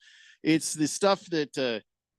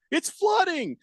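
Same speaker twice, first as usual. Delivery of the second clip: panicked wife; 4 dB louder, slower than usual. Retold or imitated. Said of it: "all of a sudden I get a text".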